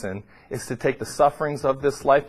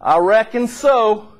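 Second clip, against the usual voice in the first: Southern drawl; 7 semitones higher, 5 dB louder, slower than usual. Retold or imitated. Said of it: "inculcated right into their dialect".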